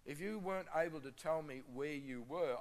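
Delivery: usual speaking voice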